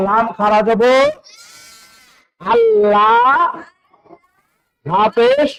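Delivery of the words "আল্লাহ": আল্লাহ high, with a long held লা; drawn-out vowel. Shown as a demonstration. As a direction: stretch the লা of আল্লাহ slowly. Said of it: "এক আলিফ পরিমাণ টেনে পড়তে হবে". (আল্লাহ-র লা টেনে উঁচু সুরে)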